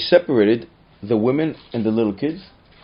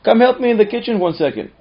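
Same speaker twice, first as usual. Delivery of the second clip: higher, louder call across the house; mother calling out; 11 semitones higher, 7 dB louder than usual. Retold or imitated. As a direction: call a daughter to help. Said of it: "she says to her".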